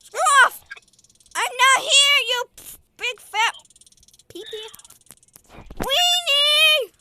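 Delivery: high loud squealing insults; playful panicked yelling. Pretend. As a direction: shriek insults while fleeing.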